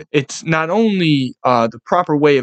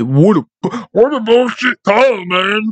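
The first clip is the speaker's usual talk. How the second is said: dumbass whiny-player impression, pitched up; Patrick Mahomes; 4 semitones higher, slower than usual. Quarterback complaining to opponent after the game, mocking dumbass voice. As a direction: put on a higher, mocking dumbass voice. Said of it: "with his fucking dumbass voice".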